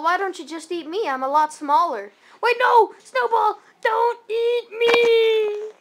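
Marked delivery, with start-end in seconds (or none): 2.4-5.7 loud high panicked yell, dragged out. Acted. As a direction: scream as the dog eats you. Being eaten, yelling.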